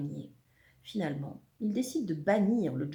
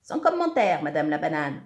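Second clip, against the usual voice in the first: insolent retort; 4 semitones lower, 7 dB louder than usual. A narrator's reading. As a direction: toss back a brash insult. Zekeye coupe court avec insolence.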